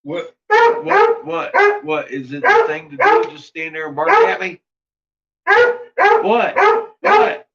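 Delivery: high put-on squeaky voice, exasperated; squeaky dog voice. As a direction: ham up a squeaky indignant pet. Voicing dogs arguing in play.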